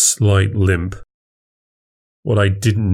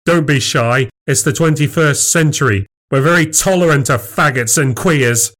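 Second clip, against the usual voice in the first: higher, mock-tolerant jeer; hostile taunting; 6 semitones higher than usual. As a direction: needle him with false encouragement.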